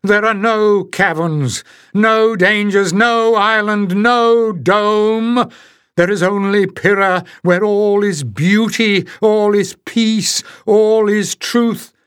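high, loud, slow insistent chant; ranting. A narrator's reading. The piper insisting to his people there is only Pyrrha, loud high rant. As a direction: hammer each phrase home, high and loud.

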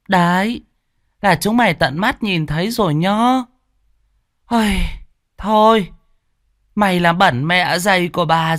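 high, shrill exaggerated outrage; hammed-up fuss. A narrator's reading. Mistress wails over the blood-stained ledger. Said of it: "bà lên bố lu bố loa lên bắt vạ".

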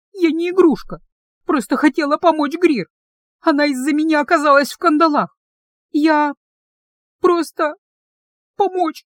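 high, tearful broken pleading; through sobs. A narrator's reading. Heroine crying to the guard about Grir, through sobs. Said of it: "прорывались сквозь рыдания".

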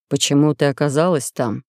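deep, solemn ruler's voice; low sultan voice. A narrator's reading. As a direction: speak as a grave, commanding sultan.

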